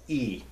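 "E" as the vowel in eel; long E. Shown as a demonstration. Teacher shows the wrong sound, long E as in eel. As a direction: say "E" like sea's vowel. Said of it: "long E, like eel or sea".

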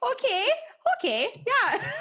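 high Canadian okay, laughing; Canadian accent. Shown as a demonstration. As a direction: lean into the Canadian vowel. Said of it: "those, like, A Y words".

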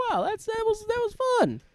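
very high, squeaky mock-delighted voice. Viewer reacting to a silly sketch, in high mock delight. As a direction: pitch it way up in playful amazement.

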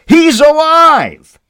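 "He's alive" slow, very high and loud, "He's" stretched; shouted he's alive. Acted. Disciples shouting "He's alive" to the crowd, slow, high and loud. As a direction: proclaim "He's alive" at full voice.

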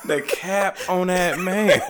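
high, slow words through laughter; laughing. Real, not acted. Friend laughing at the nephew's cap, high and slow.

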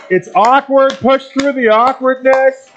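high, projected exhortation. Real, not acted. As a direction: push them on over the noise, pitched high. Urging clapping, chanting congregation on.

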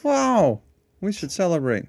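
high, loud delighted wow; excited. Real, not acted. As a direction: burst out with celebration. Thrilled by hundredth episode.

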